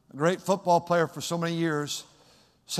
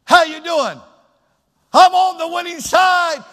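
higher, louder, ringing reply; cheerful answer; 12 semitones higher, 11 dB louder than usual. Shown as a demonstration. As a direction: answer like you're winning. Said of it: "Have your voice trained to say".

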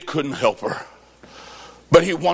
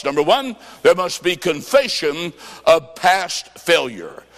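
ordinary talk

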